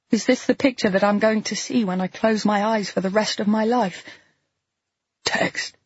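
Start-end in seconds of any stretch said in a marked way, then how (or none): 5.2-5.7 a breathless, unvoiced gasp. A narrator's reading. Dying James gasping a word to Lee. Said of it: "he gasps".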